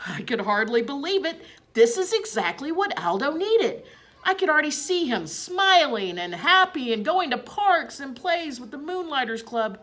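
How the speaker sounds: a hamster who can't contain his glee; excited. A narrator's reading. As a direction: rush it out, thrilled by the plan.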